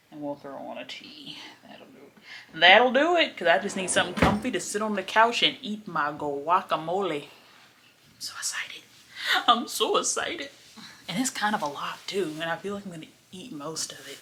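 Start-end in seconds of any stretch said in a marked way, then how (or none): 0.1-2.6 whispering. Real, not acted. Whispered aside. 2.6-3.4 higher, louder and bright; excited. Real, not acted. Repeated aloud. 7.2-8.8 slow excited whisper. Real, not acted. Whispered then repeated aloud. 9.5-10.5 high and giddy; excited. Real, not acted. Repeated aloud.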